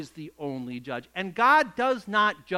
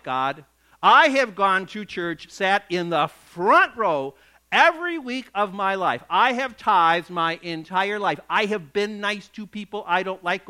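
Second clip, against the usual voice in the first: self-righteous complaint; 8 dB louder than usual. Acted.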